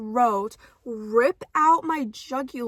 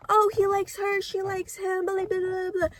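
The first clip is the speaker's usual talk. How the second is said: high, sing-song mockery; mocking; 6 semitones higher than usual. Mocking insta love in romance books, high and sing-song. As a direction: parody the cliché dismissively. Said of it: "I hate the".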